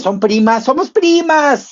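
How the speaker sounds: high imitating voice; mock girly quote. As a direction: squeal it like two excited girls. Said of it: "Si me dicen".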